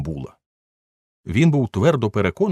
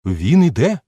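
higher, louder relay of the news; excited echo; 7 semitones higher, 6 dB louder than usual. Acted. Guest on the stairs relaying news, excited and loud. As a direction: pass the news up eagerly. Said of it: "підхопив хтось вище".

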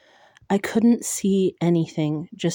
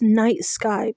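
ordinary delivery, nothing marked

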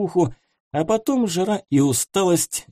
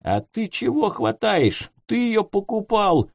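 high, offended sailor shout; indignant outburst; 8 semitones higher than usual. A narrator's reading. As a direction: flare up in wounded pride. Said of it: "взвился оскорблённый в лучших чувствах".

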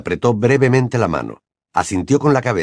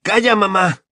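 shrill cry; 10 semitones higher than usual. A narrator's reading. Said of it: "chilló Ron".

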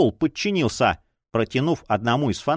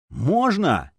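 high, eager pleading question; 5 semitones higher than usual. A narrator's reading. Champion asks the chief for his prize, pleading. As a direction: beg for permission. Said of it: "с мольбой посмотрел на главного".

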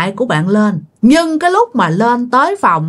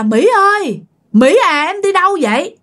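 calling out; 9 semitones higher than usual. Retold or imitated. Dying husband calling his wife back to his bed. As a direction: call her name as she walks away. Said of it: "Chồng của bà kêu bà".

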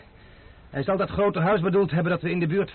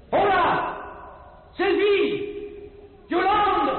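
calling out; much slower than usual. Acted. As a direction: shout the names to find them. Calling out into empty castle.

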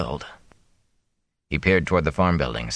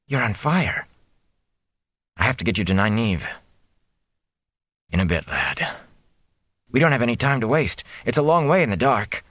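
higher, urgent pleading son; anxious young man; 4 semitones higher than usual. Acted. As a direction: push him, worried. Rand pleads with his father.

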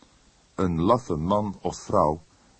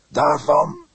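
a hoarse, raised priest's voice, much higher and louder; 9 semitones higher, 9 dB louder than usual. A narrator's reading. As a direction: push it out hoarsely with conviction. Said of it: "sprak hij hees". (hees en luid uitgesproken)

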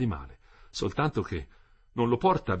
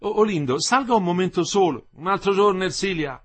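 raised, high calling voice; 9 semitones higher, 7 dB louder than usual. A narrator's reading. Ersilia asks Olindo up, he declines, calling out. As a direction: call it across to the street. Calling between window and street.

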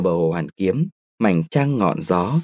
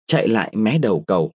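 ordinary delivery, nothing marked

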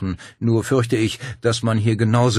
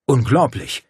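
blurted out; 6 semitones higher, 7 dB louder than usual. A narrator's reading. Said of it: "entfuhr es dem Japaner".

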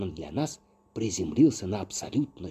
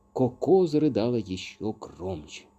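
plain reading voice

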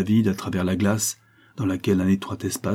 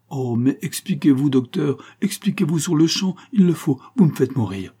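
high, louder urgent plea; agitated, insistent, pitched up; frantic pleading; 6 semitones higher, 4 dB louder than usual. A narrator's reading. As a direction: beg desperately for an explanation. Countess begs doctor to explain.

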